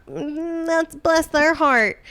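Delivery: high, slow patronizing sigh; condescending drawl. Acted. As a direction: pat them on the head with it. Men mocking women, voiced.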